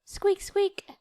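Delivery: high squeaky mouse noise; little mouse showing off her squeak. Acted. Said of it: "Can you squeak like this?".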